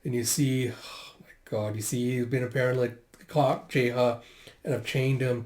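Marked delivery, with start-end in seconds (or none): 0.7-1.8 slow, voiceless hush; appalled whisper. Real, not acted. Reader sees the chained boy, appalled whisper. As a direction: breathe it out in disbelief. Reader's own shocked reaction.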